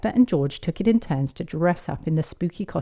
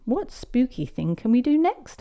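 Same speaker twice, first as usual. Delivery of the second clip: put-on higher, rising little-girl voice; eager child question; 7 semitones higher than usual. Peppa eagerly asking grandparents.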